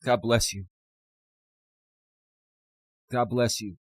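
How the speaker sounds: barely holding it together; choked up. Real, not acted. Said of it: "I'm a little emotional".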